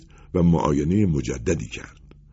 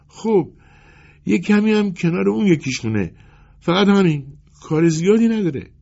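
high-pitched, halting plea to a tailor; timid customer making light of the damage; anxious customer Akaki; 10 semitones higher than usual. Acted. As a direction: talk the tailor round, high and hesitant.

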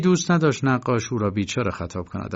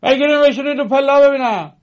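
very high, very loud, fast roar; 10 dB louder, faster than usual. A narrator's reading. Titorelli roaring at the girls to sit on the stairs. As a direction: bellow the order so the whole stairwell hears. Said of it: "تیتورلی نعره زد".

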